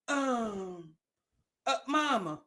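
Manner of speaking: put-on low, soft, hesitant stammering child; stammering kid asking mama. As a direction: mumble like a scared kid begging for money. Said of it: "and you be like".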